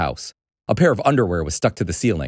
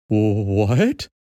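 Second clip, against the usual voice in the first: dazed, rising question; barely manage it, in shock; stunned dad; 4 semitones higher than usual. Acted. Dad frozen in shock at the creature, dazed. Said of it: "his dad managed".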